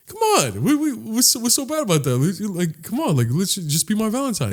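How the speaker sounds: high, wheedling come-on; flirty pleading. Retold or imitated. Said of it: "this is flirting".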